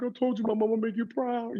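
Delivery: high, choked-up mock voice; emotional son. Son tells mama he made her proud, hammed-up emotion. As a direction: squeak it out as if overcome. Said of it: "I was emotional too".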